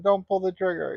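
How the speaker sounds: a hint of a terrified plea, hammed up; mock begging coward. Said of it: "they're crying in their shit, you know, saying".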